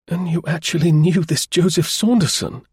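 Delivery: high-pitched, amazed question; wondering. A narrator's reading. Danvers questioning Cray about Saunderson, wondering. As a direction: exclaim it in wonder. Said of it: "he exclaimed wonderingly".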